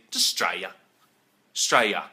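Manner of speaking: Australia cut to Strayer; very Aussie. Shown as a demonstration. Showing the most Aussie way, clipped Strayer. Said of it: "really simple and really Aussie".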